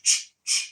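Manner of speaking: ch, ch said as sharp breathy bursts; unvoiced ch sound. Shown as a demonstration. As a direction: spit out ch, ch with no voice. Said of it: "the unvoiced ch".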